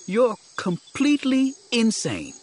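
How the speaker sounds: high-pitched, stretched-out disbelief; incredulous outburst. Acted. Companion telling Jedi he's gone bats, incredulous. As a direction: exclaim it high, appalled at him.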